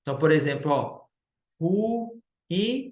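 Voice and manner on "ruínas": "ruínas" broken into ru, í, nas with pauses; syllable by syllable. Shown as a demonstration. Teacher showing the hiatus, "ruínas" split into ru, í, nas. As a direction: sound out "ruínas" as three separate, drawn beats. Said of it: "eu separo sa".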